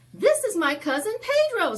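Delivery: put-on quick, high and loud kid voice; high child's voice. Sancho introducing his cousin to the neighbor kids, high and bright. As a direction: announce it proudly like a little boy.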